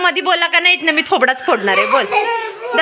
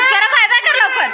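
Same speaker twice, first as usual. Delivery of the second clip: high, raised-voice urging; insistent calling out; 11 semitones higher than usual. Real, not acted. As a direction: pitch shoots up, pressing for a response. Urging child to say bye.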